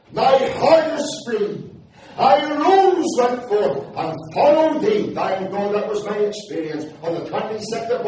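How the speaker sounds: rising revival-preacher fervour; fiery preaching. Acted. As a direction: preach it out to the whole hall. Preaching at full force.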